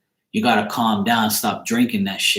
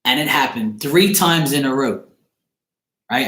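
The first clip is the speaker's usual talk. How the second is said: normal talking voice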